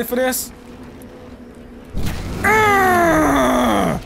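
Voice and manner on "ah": battle yell. Real, not acted. Battle cry as he attacks.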